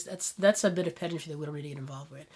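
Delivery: ordinary talk, nothing marked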